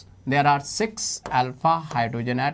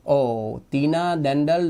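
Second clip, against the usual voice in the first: ordinary talk, nothing marked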